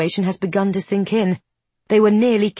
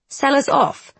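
cold, cutting question; sharp edge; 7 semitones higher than usual. A narrator's reading. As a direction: say it with a knife's edge. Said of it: "a knife's edge in his voice".

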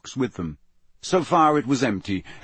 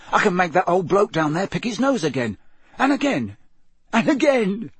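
put-on high boyish delight, repeating eagerly; gleeful; 8 semitones higher than usual. A narrator's reading. Ron plays with the replay knob, gleeful. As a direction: lift each repeat higher with glee.

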